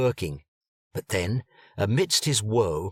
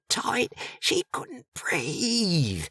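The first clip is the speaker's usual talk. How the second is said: hushed, slow secret whisper; whispered gossip; 13 semitones higher, much slower than usual. A narrator's reading. Gossip leans close to share the juicy secret, whispering. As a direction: lean in and whisper it slowly.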